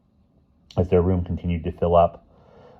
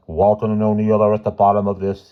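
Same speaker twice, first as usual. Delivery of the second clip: firm; 3 semitones higher, 5 dB louder than usual. A narrator's reading. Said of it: "he said firmly".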